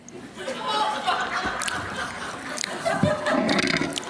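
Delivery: high long laugh. Real, not acted. After the punchline.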